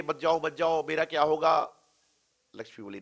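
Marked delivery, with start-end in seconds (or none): 0.0-1.8 loud, high-pitched begging as the merchant; urgent mock begging, loud and high; retold pleading. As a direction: act out a desperate plea, raised in pitch and volume. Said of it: "सेठ रो-, गिड़गिड़ाया, रोया".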